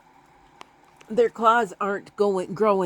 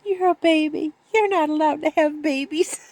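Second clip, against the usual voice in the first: scolding kitten; 9 semitones higher than usual. Retold or imitated. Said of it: "she says".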